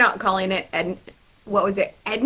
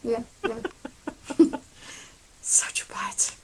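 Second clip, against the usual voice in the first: unvoiced whisper, playful; whispered playful jab; whispered tease; 5 semitones higher, 5 dB quieter than usual. Real, not acted. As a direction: whisper it as a teasing aside. Teasing aside to co-host.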